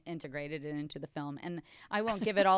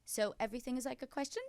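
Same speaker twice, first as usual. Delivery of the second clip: higher, with QUESTION lifting like a question; uptalk; 6 semitones higher, 4 dB quieter, faster than usual. Shown as a demonstration. Explaining how grown women talk, in demonstrated uptalk. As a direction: end on "question" with a questioning rise.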